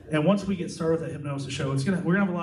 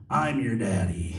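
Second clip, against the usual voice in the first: slow, low, lightly angry reply; hint of a grumpy dad; a touch of mock anger, slow and low; mock angry demo; 5 semitones lower, slower than usual. Shown as a demonstration. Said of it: "respond in a nice angry tone".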